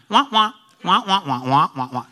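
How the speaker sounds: Charlie Brown teacher's trombone voice; droning adult gibberish, wah wah; muffled wah wah talk. Retold or imitated. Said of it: "The teacher".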